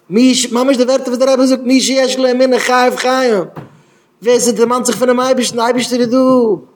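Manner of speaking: high, loud preacher's outcry; impassioned cry. Acted. Preacher calls out the Rebbe's words, impassioned cry. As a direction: cry it out at full force, high-pitched.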